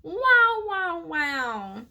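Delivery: high wailing cat yowl; cat-like whinny. A narrator's reading. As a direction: yowl like a cat left outside. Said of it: "sounded like a cat left out on the porch".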